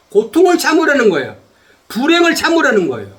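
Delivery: impassioned preaching. Real, not acted. Preacher urging the congregation to endure suffering, impassioned. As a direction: preach it insistently, driving each phrase home.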